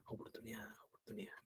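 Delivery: whispered echo. Acted. Repeating God's given chances.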